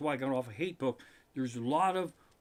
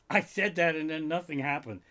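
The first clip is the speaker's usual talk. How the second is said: normal talking voice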